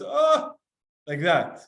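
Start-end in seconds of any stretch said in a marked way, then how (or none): 0.0-0.5 high, loud sighing oh; demonstrated sigh of an exhale; exhaled sigh. Shown as a demonstration. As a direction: let out one long exhale. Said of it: "It's not a cry, but it's".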